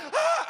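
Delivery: very high, short acted shriek; mock startled scream. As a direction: yelp in mock fright, way up high. Woman's scream acted again.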